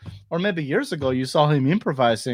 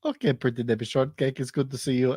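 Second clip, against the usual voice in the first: ordinary talk, nothing marked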